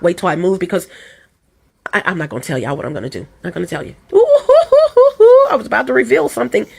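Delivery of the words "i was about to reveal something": laughing high squeal at herself. Real, not acted. Vlogger laughing at almost spilling a secret. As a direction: squeal through a laugh, caught out. Catches herself about to reveal.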